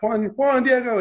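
high, joyful call of a name; excited greeting. Retold or imitated. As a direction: greet him like an old friend, delighted. Director greets actor excitedly.

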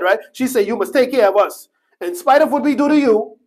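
high, loud, driving sermon cry; preaching at full force. Acted. Preacher calling out the unrepentant brothers, loud and high. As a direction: pound the point home.

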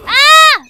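high, loud frustrated yell. Real, not acted. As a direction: blast out a sudden cry. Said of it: "I think I might be too loud".

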